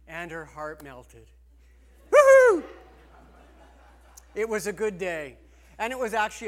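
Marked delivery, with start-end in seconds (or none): 2.1-2.6 very high, very loud celebratory whoop; shrieked woo-hoo, high and loud; joyful whoop. Retold or imitated. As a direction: let out a wedding cheer at full volume. Cheering at the wedding.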